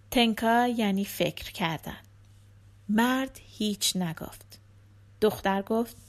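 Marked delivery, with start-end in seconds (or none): none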